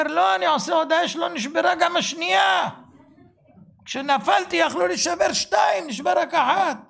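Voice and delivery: mock exclaim it loud and high, thankful; acting out a man thrilled only one leg broke, loud and high; imitating a joyful shout. Retold man's joyful reply.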